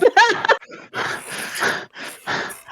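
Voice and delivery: a high, breathy burst of laughter; real laugh. Real, not acted. GM laughing at a player's sneaky question, a high real laugh.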